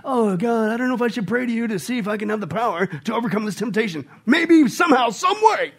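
put-on wishy-washy praying; no-brainer prayer. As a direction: pray like a doubter, pitch rising into a plea. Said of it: "I call it a no-brainer prayer".

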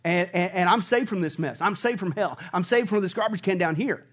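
ordinary delivery

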